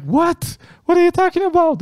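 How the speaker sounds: very high-pitched, incredulous cry; acted high-voiced protest; alarmed disbelief. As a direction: call back in panicked confusion. Scared woman calls back, acted.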